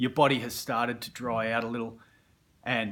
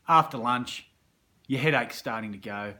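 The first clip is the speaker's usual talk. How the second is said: ordinary talk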